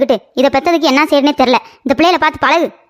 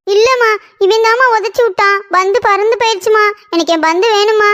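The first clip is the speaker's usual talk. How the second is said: high tearful whine to mother; acted sobbing tattle; crying complaint; 5 semitones higher than usual. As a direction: wail it like an upset child. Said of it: "இரு, அழுகாத".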